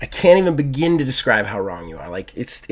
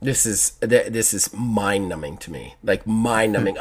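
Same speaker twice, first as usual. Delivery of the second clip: mind-numbed; 4 semitones lower, 8 dB quieter, slower than usual. Real, not acted. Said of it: "I'm actually furious right now".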